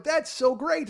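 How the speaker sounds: loud, high imitated enthusiasm; gushing praise. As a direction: gush as if delighted. Retelling an over-enthusiastic director.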